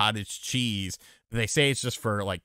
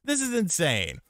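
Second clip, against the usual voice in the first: high mock disbelief. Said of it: "I was like".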